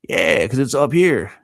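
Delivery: ordinary delivery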